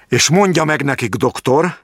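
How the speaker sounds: high, firm start of a threat; stern warning. A narrator's reading. Jáki warning the doctor before raising his voice. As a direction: point the finger and warn him.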